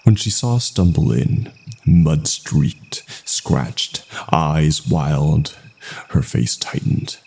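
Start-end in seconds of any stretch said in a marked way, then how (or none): none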